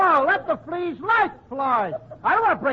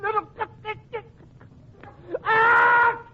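building sneeze; 7 semitones higher, slower than usual. Acted. Comic telling friends about his cold, building sneeze. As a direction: stammer into an explosive, high "ah!". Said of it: "Did you hear Costello sneeze?".

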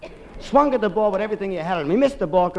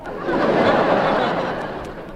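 short breathy laugh at his own aside; own chuckle; 5 semitones higher than usual. Real, not acted. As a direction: chuckle at the joke.

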